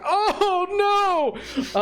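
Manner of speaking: long, high "oh no"; acted dismayed wail. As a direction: cry out in hammed-up despair. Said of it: "and he's like".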